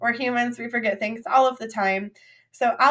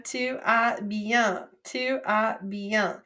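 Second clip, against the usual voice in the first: slow model answer; much slower than usual. Shown as a demonstration. Teacher models French answer slowly.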